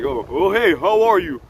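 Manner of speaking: high, loud, startled then sweet hello; surprised friendly reply. Retold or imitated. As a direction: caught off guard and delighted. Tough guy answers surprised, sweetly.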